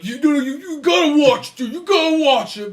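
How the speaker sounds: high, loud, overexcited fan plea; mock pushy urging. Host pretending to insist his co-host watch the shows, pushy.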